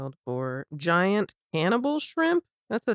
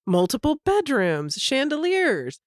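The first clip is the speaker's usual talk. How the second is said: ordinary delivery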